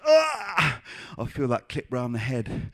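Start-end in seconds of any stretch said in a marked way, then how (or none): 0.0-0.8 high, loud mock cry of pain; pained yelp. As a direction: yelp as if clipped round the head. Acts being clipped round head.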